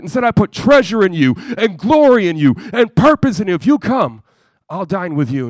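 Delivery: loud, high, rising preacher's cry; preaching at full force. Acted. Preacher calling to the hurting crowd, loud and rising. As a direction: build the altar call to a peak.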